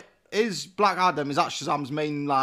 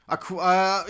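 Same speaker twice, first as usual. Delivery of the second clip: usual speaking voice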